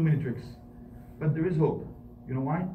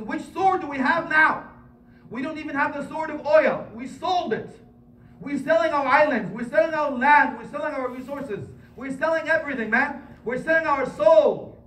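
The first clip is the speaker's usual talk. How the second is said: higher, louder, indignant preaching; impassioned rant; 10 semitones higher, 4 dB louder than usual. Acted. Imam berating his community, indignant rant. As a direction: rail against selling everything. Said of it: "It says".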